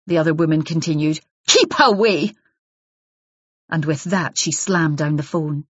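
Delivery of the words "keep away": curt warning. Acted. Wife's final warning.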